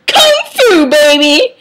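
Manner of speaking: very high, loud whoop; hammed-up kung fu yell; battle cry. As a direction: shout it like a cheesy action move. Battle cry while kicking.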